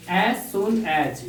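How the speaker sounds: very slow, word by word; teacher writing a phrase on the board; slow dictation. Shown as a demonstration. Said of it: "जैसे ही".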